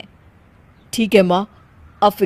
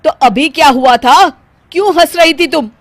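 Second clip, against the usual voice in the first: angry demand; 7 semitones higher, 8 dB louder than usual. Acted. Husband demands explanation.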